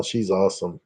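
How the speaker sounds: normal talking voice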